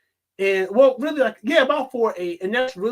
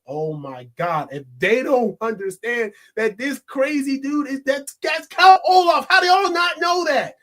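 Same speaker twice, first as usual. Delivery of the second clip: exasperated outburst; 6 semitones higher, 4 dB louder than usual. Retold or imitated. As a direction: beg the characters to see the obvious. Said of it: "I remember reading the books and I was like".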